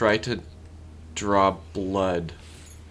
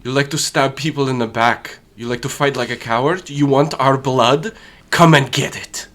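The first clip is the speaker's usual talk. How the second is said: loud, higher challenge to hidden enemies; taunting battle shout, building; acted yell; 7 semitones higher, 9 dB louder than usual. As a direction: yell it so it echoes through an empty temple. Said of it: "I'll turn to the empty temple and just yell".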